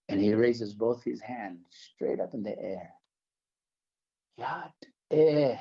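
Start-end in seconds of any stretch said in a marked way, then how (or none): 4.4-5.6 raised, louder and drawn out, with arms up; Ken's reverent cry. Retold or imitated. Ken answering with arms raised, a reverent higher cry. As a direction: call the holy name out like Ken did. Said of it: "he goes".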